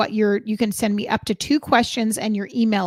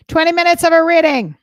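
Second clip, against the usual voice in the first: excited; 7 semitones higher, 10 dB louder than usual. Real, not acted. Hyping giveaway prize to viewers.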